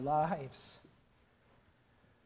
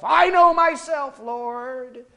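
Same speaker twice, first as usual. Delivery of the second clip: high, loud protest; Peter insisting; 9 semitones higher, 13 dB louder, much slower than usual. Retold or imitated. Peter protesting to the Lord, high and insistent. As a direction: insist stubbornly. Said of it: "Peter insisting to the Lord".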